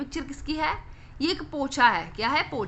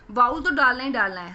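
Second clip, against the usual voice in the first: usual speaking voice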